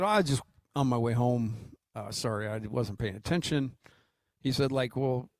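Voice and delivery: ordinary delivery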